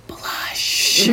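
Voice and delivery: breathy secret whisper of Blush; whispered reveal. Acted. To friends about the pink shoes, a teasing whispered reveal. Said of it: "you know what color those are?".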